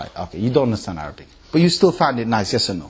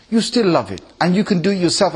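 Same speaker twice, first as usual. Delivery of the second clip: ordinary talk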